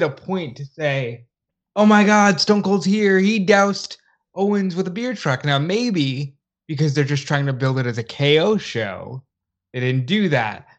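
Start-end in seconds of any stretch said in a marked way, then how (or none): 1.8-5.4 raised, loud hype call, easing off; mock excited announcer. Co-host imagining how Raw would announce Austin, mock excited. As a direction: call it out like a big surprise arrival.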